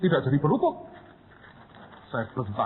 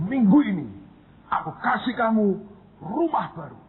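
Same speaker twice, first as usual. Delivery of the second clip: loud call; 8 semitones higher than usual. Retold or imitated.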